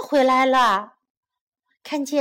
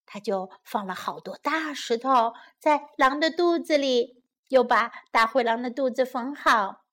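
ordinary delivery, nothing marked

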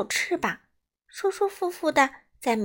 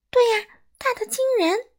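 very high, louder squeal; proud exclamation; 13 semitones higher, 5 dB louder than usual. A narrator's reading. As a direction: pipe up emphatically in a high voice. Bat boasts of its loud call.

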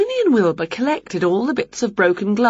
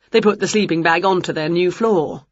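usual speaking voice

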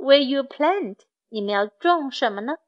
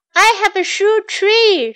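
higher-pitched, moderate narrated shout; storybook shout; 7 semitones higher, 4 dB louder than usual. A narrator's reading. Mark shows off his shoe tree, high moderate shout. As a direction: call out high, as a child in a story. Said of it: "shouted Mark".

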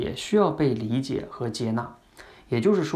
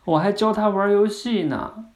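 put-on high, drawn-out child voice; acted young daughter, pitched up; little daughter; 8 semitones higher, 4 dB louder than usual. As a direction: play a small girl remembering her pet, slow and high. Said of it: "女儿会说什么".

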